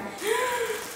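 quick high gasp of delight; startled little gasp; sharp gasp. Real, not acted. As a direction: suck in a surprised breath. Spots something else in box.

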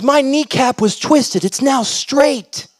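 high, slow, drawn-out proclamation; elated. Acted. [name] announces his kneecap is healed, slowly and elated. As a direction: stretch the words out high in amazement.

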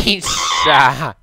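very loud, high startled question; alarmed shout. Real, not acted. Player asks where the creeper is, alarmed shout. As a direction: shout it in a sudden panic.

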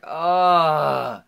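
loud, drawn-out frustrated groan; high, long groan of protest. Retold or imitated. Acted frustrated reaction to mom's threat.

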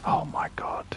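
whispering. Real, not acted. Not going to end well.

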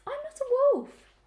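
very high, loud, slow piglet voice; protesting piglet. A narrator's reading. Piglet answering the sheep under the door, protesting.

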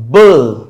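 "bull" hit loud and held alone; stressed syllable. Shown as a demonstration. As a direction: punch out "bull" as the strong beat. ("bull" पर अलग से ज़ोरदार बलाघात)

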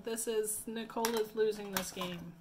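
ordinary talk

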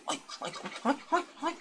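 high, whining pig cries; squealing pig. Retold or imitated. Narrator voicing the pig his mother scratched, high squeals. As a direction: whine like a pig begging for scratches. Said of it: "el chancho decía".

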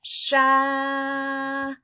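put-on the blend "sha" said for children to copy; blended sound. Shown as a demonstration. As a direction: model "sha" again slowly. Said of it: "you try it".

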